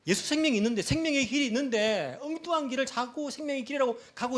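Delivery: high, impassioned preacher's lament; unbearable anguish. Acted. Preacher grieving over a society on the wrong road, anguished outcry. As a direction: cry out why, drawing out each final why. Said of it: "견딜 수 없는 이 마음".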